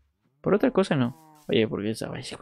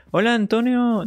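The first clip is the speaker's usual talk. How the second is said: cheery greeting; 10 semitones higher, 4 dB louder than usual. Real, not acted. Streamer greets viewer in chat.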